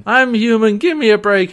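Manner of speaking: high, loud begging for leniency; mock whining plea. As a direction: plead your case, hammed up. [name] whining excuses to Eren.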